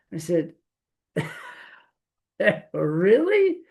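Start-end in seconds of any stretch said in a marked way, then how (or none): 2.7-3.6 high, rising disbelief; mock incredulous. Challenging a friend who claims he is happy, incredulous. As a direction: question it as if you can't believe it.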